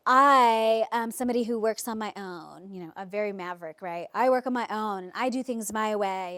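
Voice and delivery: loud, assertive mock client; self-assured client. Client telling the coach how they work, self-assured and loud. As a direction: declare it as someone set in their ways. Acted quote of a former client.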